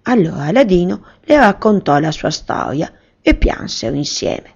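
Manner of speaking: plain reading voice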